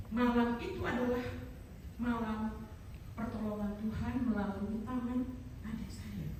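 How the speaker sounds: soft, solemn testimony; hushed reverence. Real, not acted. Speaker crediting God's help through a sibling's hands, hushed and reverent.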